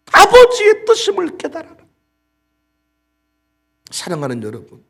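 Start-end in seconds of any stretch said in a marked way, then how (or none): 0.1-1.8 high, loud commanding proclamation; raised pulpit cry; preaching shout. Acted. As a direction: thunder it to the congregation. Preaching climax.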